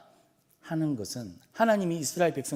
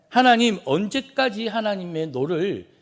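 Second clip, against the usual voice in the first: loud, raised cry to God; high, forceful intercession; urgent plea; 6 semitones higher, 6 dB louder than usual. Acted. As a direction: call out to God in protest. Acting angel's plea to God.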